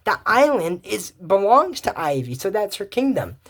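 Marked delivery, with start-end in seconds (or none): none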